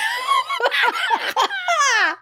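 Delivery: long, very high burst of laughter; high-pitched laugh. Real, not acted. Host cracking up mid-story with her co-host, high laughing. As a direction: crack up, voice shooting way up.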